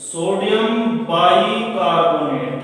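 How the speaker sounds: drawn-out, syllable-by-syllable dictation; very slow teacher's dictation. Real, not acted. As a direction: stretch each word out for note-takers. Teacher dictating a compound name.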